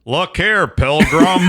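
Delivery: rough workshop take on a movie cowboy; John Wayne. Acted. Podcast host interrupts the biography with a cowboy star impression. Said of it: "my John Wayne voice tonight".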